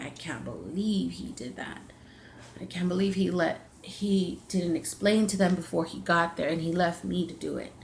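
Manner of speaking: low, quiet angry grumble; retold quiet fuming in the car; mad muttering. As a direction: mutter it under your breath, seething. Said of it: "I was mad".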